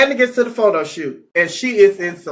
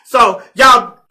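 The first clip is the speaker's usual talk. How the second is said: heated rant; 3 semitones higher, 4 dB louder than usual. Real, not acted. Heated rant about raising child.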